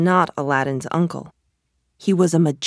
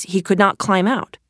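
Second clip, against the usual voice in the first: usual speaking voice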